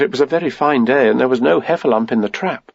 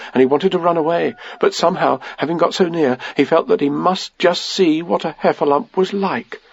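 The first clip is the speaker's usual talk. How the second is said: plain reading voice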